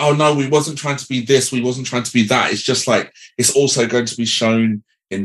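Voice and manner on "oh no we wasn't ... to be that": mock backpedalling. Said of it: "they got boomy and was like".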